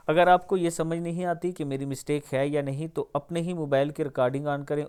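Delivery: ordinary delivery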